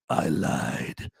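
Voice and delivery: very low, quiet action-hero voice; Arnold Schwarzenegger catchphrase. Acted. Quoting an eighties Schwarzenegger catchphrase, low quiet deadpan delivery. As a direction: drop deep for the punchline. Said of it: "like Schwarzenegger back in the eighties".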